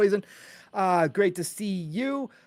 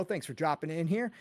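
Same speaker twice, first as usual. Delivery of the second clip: ordinary delivery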